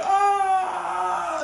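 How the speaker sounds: high, loud howl of pain; hornet-stung yell. Retold or imitated. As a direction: howl as the hornets sting you. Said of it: "he's running through the woods going like".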